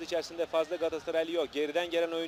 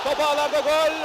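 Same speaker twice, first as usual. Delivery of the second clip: screaming goal call; 11 semitones higher, 11 dB louder than usual. Real, not acted.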